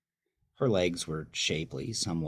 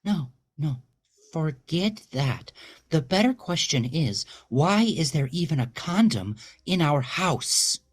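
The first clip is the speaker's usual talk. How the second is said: much higher and louder, building fury; ruthless outburst; 11 semitones higher, 8 dB louder than usual. Acted. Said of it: "only makes her more ruthless".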